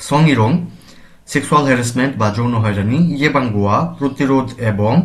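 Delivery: ordinary delivery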